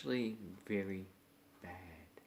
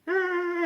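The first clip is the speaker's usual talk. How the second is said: mock cry; 9 dB louder than usual. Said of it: "and they go".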